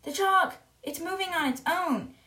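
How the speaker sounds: high-pitched retold cry of alarm; acted panicky exclamation; mock scream. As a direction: cry out like a hysterical pupil, without full volume. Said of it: "looking at the chalk and screaming".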